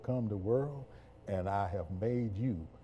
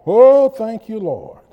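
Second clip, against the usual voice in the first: much higher and louder cry of thanks; praise shout; 11 semitones higher, 19 dB louder, slower than usual. Real, not acted. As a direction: burst out in praise. Preacher bursts into praise.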